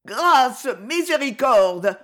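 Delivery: loud, high cry for mercy; vociferating. A narrator's reading. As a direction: beg loudly under the blows. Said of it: "vociférait Cogolin". (supplication criée, voix aiguë)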